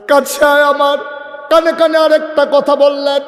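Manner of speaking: high, loud chanted storytelling; impassioned preaching. Real, not acted. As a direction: lift the voice into a ringing chant.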